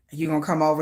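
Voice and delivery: a deep, laid-back come-on; low smooth invite. Retold or imitated. Voicing [name] inviting her over, a low coaxing question.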